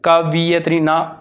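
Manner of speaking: ordinary talk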